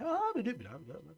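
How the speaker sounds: high babble dropping away; varied speech intonation. Shown as a demonstration. Therapist contrasting chatty speech with singing, babbled intonation. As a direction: gabble nonsense with swooping speech tunes. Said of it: "ela é muita entonações".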